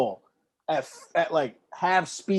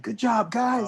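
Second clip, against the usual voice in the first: put-on water-boy cheer; 6 semitones higher, 3 dB quieter, slower than usual. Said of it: "I'll be handing out water".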